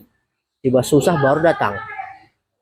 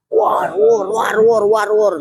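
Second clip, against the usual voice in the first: high rapid war war babble; mock babbling; 4 semitones higher, much slower than usual. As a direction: gabble it like idle noise. Imitating rushed chatter. (ocehan war war yang tinggi dan cepat)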